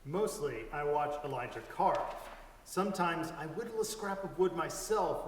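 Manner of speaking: usual speaking voice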